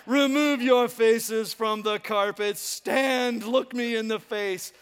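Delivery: loud, slow, booming proclamation; god-king command. Acted. Preacher playing sun god to his people, grandly loud. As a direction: command the prostrate crowd with mock majesty. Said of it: "I wanna say".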